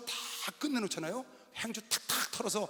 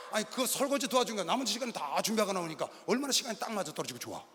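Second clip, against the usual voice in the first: usual speaking voice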